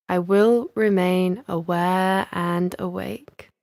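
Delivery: very slow, deliberate affirmation; meditation affirmation. Shown as a demonstration. Teacher giving listeners words to say to themselves, slow affirmation. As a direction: draw out each word for them to repeat inwardly. Models a resolve to repeat inwardly.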